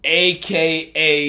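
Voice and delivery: drawn-out announcer build-up; slow announcement. Shown as a demonstration. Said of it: "Fresh off of real life".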